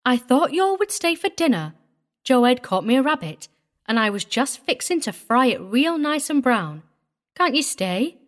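put-on high, coaxing country girl; pouting. A narrator's reading. Girl urges visitors to stay for dinner, pouting. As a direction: plead with a disappointed pout. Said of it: "pouting as I sidled pointedly back".

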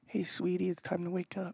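soft, gentle wake-up call; mock grandma cooing softly. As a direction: coax them awake tenderly. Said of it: "real gently and just be like".

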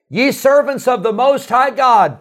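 high, loud call of the title; king calling out. Retold or imitated. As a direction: shout the title across the fire. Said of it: "He said".

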